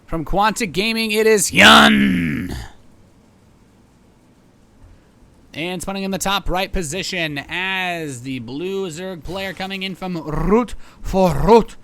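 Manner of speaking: loud, high arena announcer voice; hype announcer. Acted. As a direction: pump up the crowd like a ring announcer. Introducing the two players.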